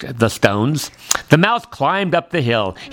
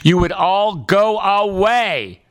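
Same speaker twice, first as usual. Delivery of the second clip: exasperated plea; 6 semitones higher, slower than usual. A narrator's reading. Fed-up mouse shouting at crickets.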